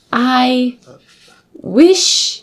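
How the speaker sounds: put-on high, drawn-out British accent, word by word; hammed-up British grandeur, rising; emphatic mock British. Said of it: "It's so emphatic".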